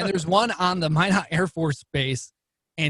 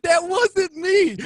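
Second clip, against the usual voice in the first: high, loud, slow wailing denial; mock wail; 13 semitones higher, 5 dB louder, much slower than usual. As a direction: wail it, half laughing. Retold desperate denial.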